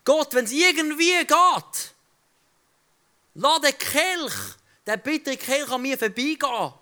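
pleading Jesus. Acted. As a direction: beg God to spare you the cup. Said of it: "Er hat gesagt".

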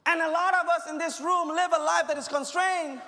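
high, loud preacher's cry building through the lines; rising, loud sermon delivery; full-force preaching. Acted. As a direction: preach it out loud to the whole room.

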